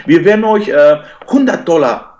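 high mock pleading voice; begging trolls. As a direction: beg in a squeaky high voice, mocking them. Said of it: "ein paar neue Typen sagen". (Trolle im Chat betteln, hohe spöttische Flehstimme)

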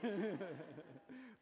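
loud nervous laugh. Real, not acted. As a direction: laugh through the nerves. Laugh after bedtime joke.

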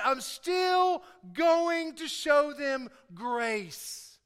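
emphatic pulpit voice, punching key words; preaching. Real, not acted. Preacher explains grace to the church, emphatic preaching. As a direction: hammer it home to the congregation.

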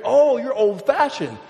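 slow, exaggerated jibe; mocking friend. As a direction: stretch it out in mock judgement. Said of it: "I remember talking to my friend".

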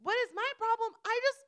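high, loud disbelief; indignant retort. Retold or imitated. Storyteller answering the angry lady, indignant. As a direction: throw the accusation back, high and loud. Retold train quarrel, indignant reply.

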